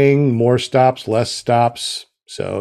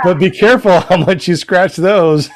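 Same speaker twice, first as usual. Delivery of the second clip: laughing; 6 semitones higher, 4 dB louder than usual. Real, not acted. Joking about scented dollar bills.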